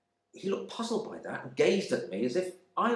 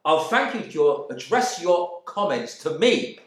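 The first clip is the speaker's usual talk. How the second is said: firm; 5 semitones higher, 5 dB louder than usual. Retold or imitated. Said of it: "I said firmly".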